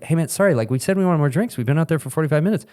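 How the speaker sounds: mildly put-on, non-confrontational plea; apologetic complaint. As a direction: act out a polite nudge to a waiter. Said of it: "I'm not a Karen normally".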